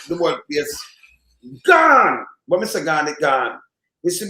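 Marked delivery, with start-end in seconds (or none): 1.7-2.2 loud exclamation. Real, not acted. Radio host exclaiming punchline.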